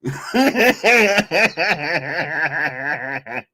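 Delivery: bursting laugh; real laugh after the mock quote; real laughter. Real, not acted. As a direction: crack up at the quote.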